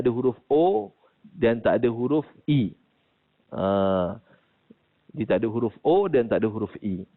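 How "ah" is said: usual speaking voice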